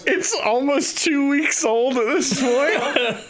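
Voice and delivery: high, drawn-out disbelief; exasperated high-pitched protest; incredulous objection. Acted. Objects to praise of bad food.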